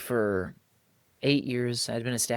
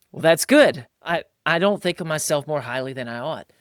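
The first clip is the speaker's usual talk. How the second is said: usual speaking voice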